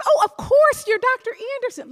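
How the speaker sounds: high, loud mock gush; gushing recognition. Usher pretending to recognise the doctor, gushing. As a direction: put on an over-eager show of knowing someone.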